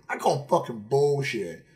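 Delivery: normal talking voice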